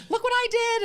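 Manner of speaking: very high, loud showy shout; hammed-up high-pitched boast at full volume; in-your-face bragging. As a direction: yell it out as a shameless brag. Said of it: "in your face".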